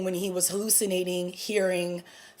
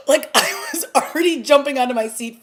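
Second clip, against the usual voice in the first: laughing excitedly; 4 semitones higher, 6 dB louder, faster than usual. Real, not acted.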